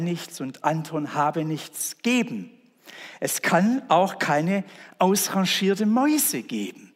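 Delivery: preacher driving home the point; preaching at full force. Acted. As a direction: proclaim it to the whole church. Preaching climax.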